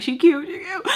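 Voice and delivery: high, loud squeaked aside; playful admission. Retold or imitated. Girlfriend secretly admitting the rival is pretty, high playful squeal. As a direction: pop up high as you concede it.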